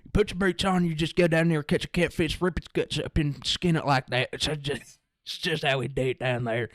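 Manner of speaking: put-on backwoods country accent. Explaining catfish skinning to lab partner, thick country accent. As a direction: talk like a country fisherman holding forth. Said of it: "I just started talking like that".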